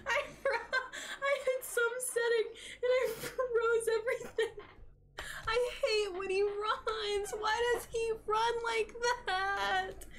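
high, slow, whiny laugh-cry; half laughing, half crying in frustration. Real, not acted. Player laughing and crying at the monster's run, whiny high wail.

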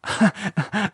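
short nervous titter; uneasy laugh to cover up. Acted. Michelle covering her fear with a nervous titter. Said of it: "she laughed nervously".

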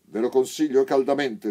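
loud, high threatening warning; stern warning. A narrator's reading. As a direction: bark it as a threat. Sergeant warns blind men.

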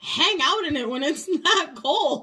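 giggling through the words, high and loud; amused giggly reply; laughing. Retold or imitated. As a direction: laugh while you explain. She giggles through her reply.

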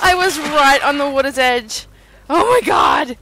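loud, high disbelief; shocked shout. Real, not acted. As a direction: cry out in shock. Sudden in-game death.

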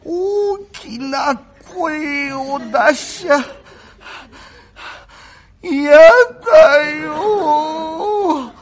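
very slow, drawn-out, whimpering sick voice; feeble invalid. Acted. The bedridden young master weakly pleading with Kuma, slow and whimpering. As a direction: barely get the words out, frail and pitiful.

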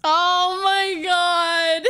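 loud, drawn-out tearful gush; overwhelmed, long-stretched exclamation; touched and overwhelmed. Real, not acted. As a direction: gush it slowly, voice high and moved. Reacts touched to friends' messages.

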